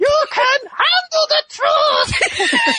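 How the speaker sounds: very high, drawn-out comic shriek; squealed parody of a dramatic movie line; mock courtroom outburst. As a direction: stretch it out in a hammed-up falsetto. Joins the mock courtroom bit.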